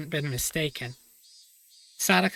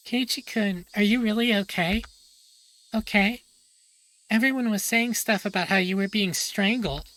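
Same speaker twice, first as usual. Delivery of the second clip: lightly voiced, higher worried girl; concerned Rena; 5 semitones higher than usual. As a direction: ask gently but anxiously, a bit higher.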